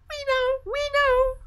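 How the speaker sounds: very high, loud chirpy bird voices; twittering sparrows. Acted. The sparrows boast to the fir tree, very high and chirpy. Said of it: "twittered the sparrows".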